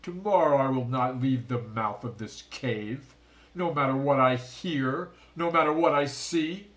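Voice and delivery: angry oath; loud determined vow. A narrator's reading. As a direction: swear it to the empty cave. Shark king vows revenge.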